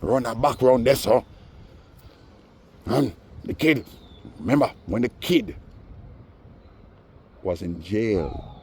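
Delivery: hammed-up Fantan impression; imitating Fantan. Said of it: "That's exactly how he said it too".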